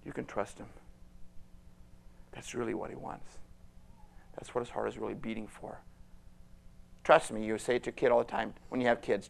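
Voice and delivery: ordinary talk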